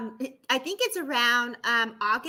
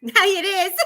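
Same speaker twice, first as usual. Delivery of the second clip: laughing; 9 semitones higher, 3 dB louder than usual. Real, not acted. Joking about taking day off.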